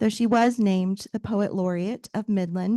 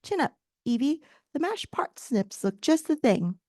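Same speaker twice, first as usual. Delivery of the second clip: put-on higher, cheery British accent; 5 semitones higher than usual. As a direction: Atlas cheering Evie up in his British accent. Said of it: "he says with a faint British accent".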